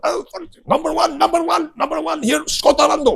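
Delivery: sing-song mock praise in a high voice; high repeated "number one" imitation; imitating Japanese teacher. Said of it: "he's going".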